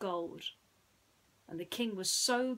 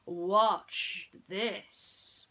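smiling prince; 6 dB louder than usual. Acted.

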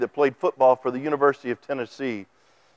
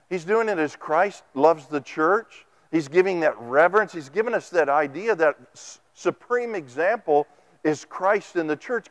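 ordinary talk, nothing marked

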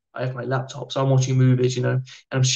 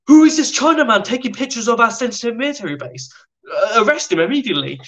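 higher, louder outraged officer; indignant commander; 9 semitones higher, 4 dB louder than usual. Retold or imitated. Commander spots photographer at his base, loud and outraged. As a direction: bark the arrest order like an outraged officer. Commander spits drink, orders arrest.

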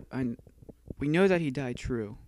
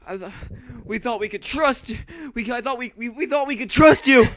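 stammering; 5 semitones higher, 9 dB louder than usual. Acted. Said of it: "At least you're getting the stagger".

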